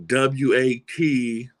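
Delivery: W A T spelled out slowly, letter by letter; the misspelling of "what" read out slowly as W A T. Shown as a demonstration. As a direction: spell W A T out one slow letter at a time.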